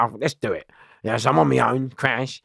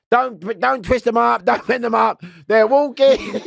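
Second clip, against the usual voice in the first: mock guard order; 11 semitones higher, 5 dB louder than usual. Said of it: "what they mean is".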